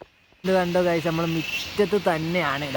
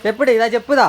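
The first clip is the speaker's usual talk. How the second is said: firm order; 5 semitones higher, 8 dB louder than usual. Real, not acted. Scolding child to wear sandals.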